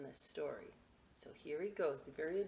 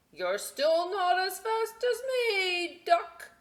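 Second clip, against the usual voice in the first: horse's boast; 14 semitones higher, 9 dB louder, slower than usual. Acted. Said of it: "what he thought was".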